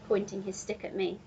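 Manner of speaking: ordinary delivery, nothing marked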